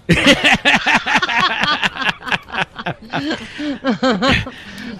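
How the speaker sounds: clopping hooves done with the mouth; galloping horse impression. Shown as a demonstration. Guest shows off for radio listeners, mouth-made galloping horse. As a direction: click out a gallop with tongue and lips, pitched high. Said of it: "my impersonation of a galloping horse".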